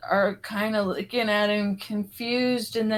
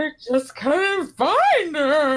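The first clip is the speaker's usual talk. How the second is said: slow, high, louder hammed-up sob voice; mock crying; 5 semitones higher, 5 dB louder, slower than usual. Grieving dad in the movie, hammed-up tearful plea. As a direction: ham up a crying father. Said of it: "he starts crying".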